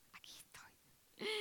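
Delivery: hushed whisper, slow; whispered reply. Retold or imitated. Ananías answering the Lord in a vision, whispering. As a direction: breathe it out as a humble answer. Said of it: "dijo Ananías".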